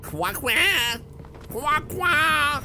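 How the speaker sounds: quacked French question, rising to high and loud; duck-like French. Acted. Streamer imitating offended French viewer, quacking like a duck. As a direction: squawk the French word like a duck. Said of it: "French people aren't ducks?".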